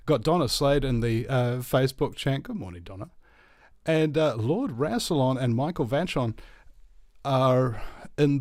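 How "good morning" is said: normal talking voice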